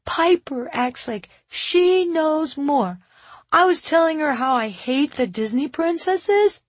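put-on high-pitched kid airing a grievance; little child's voice. A narrator's reading. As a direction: voice a small child complaining about a classmate. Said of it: "Timby's little voice".

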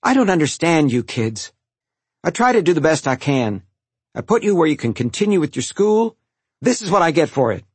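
worked-up reproach; exasperated scolding. A narrator's reading. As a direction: scold the kids, hurt and fed up. Exasperated mother scolding her sons.

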